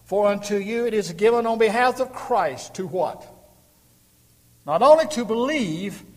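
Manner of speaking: loud and high, with a question to the crowd; fiery sermon climax; preaching at full force. Acted. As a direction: call and answer with the congregation.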